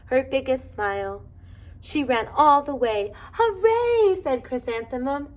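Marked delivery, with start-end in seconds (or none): 3.3-4.1 high, loud hooray; cheering. A narrator's reading. Girl cheering as she runs to school, high and loud. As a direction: burst out with joy.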